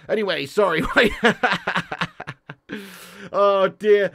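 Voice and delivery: usual speaking voice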